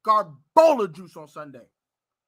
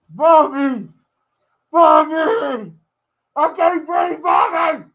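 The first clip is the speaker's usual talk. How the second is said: mock yelling for Bobby; 3 semitones higher, 7 dB louder, slower than usual. Pretend calling out in smelly house.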